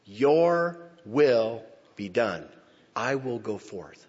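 very slow, calm and certain; accent of victory. Retold or imitated. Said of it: "said with an accent of victory".

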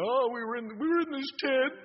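high, nasal-free whine of a complainer, hammed up; mock whining about the tent; whiny grumbling. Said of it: "I was just grumbling".

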